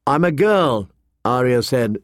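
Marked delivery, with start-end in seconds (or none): 0.1-0.9 put-on higher-pitched girlish protest; a child's mildly indignant correction; exasperated. A narrator's reading. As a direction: put the stranger right, exasperated.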